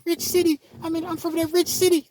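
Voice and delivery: very high-pitched, loud Richmond, Kentucky impression; Richmond, Kentucky accent. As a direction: imitate Richmond, Kentucky locals in a squeaky high voice. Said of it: "That's how they talk in Richmond".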